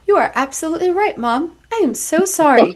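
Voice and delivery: fast, high sugary apology; a child voice no toddler ever uses; mock sweet toddler. Imagined toddler apologizing to mom, mock sweet. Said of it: "be like".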